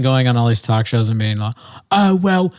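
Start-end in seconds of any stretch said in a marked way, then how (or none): 1.9-2.5 put-on high, lively British accent; British accent, raised pitch and showy; Russell Brand impression. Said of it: "Russell Brand saying it".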